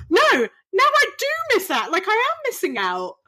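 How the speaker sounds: high, whiny mock dismay; mock wail. To the magician, high mock-dismayed whine about missing out. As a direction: wail it like a child missing a treat. Retelling her disappointment, gasps.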